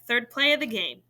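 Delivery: ordinary delivery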